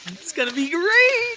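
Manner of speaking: high, slow mock cheer; sarcastic. Real, not acted. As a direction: fake enthusiasm about the storm. Sarcastic about driving in snowstorm.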